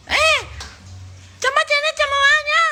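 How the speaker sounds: very high, shrill mock summons; mocking squeaky voice. Host mimics a would-be leader calling everyone over, shrill mockery. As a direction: squeal the order in ridicule.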